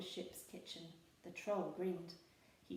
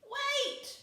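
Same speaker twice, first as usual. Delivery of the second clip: very high, loud call; crying out; 8 dB louder than usual. A narrator's reading. Peg Polkadot stopping pirates, crying out. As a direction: cry out to stop them. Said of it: "she cried".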